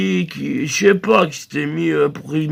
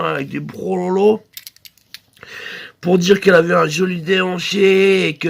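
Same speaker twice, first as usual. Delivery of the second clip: ordinary delivery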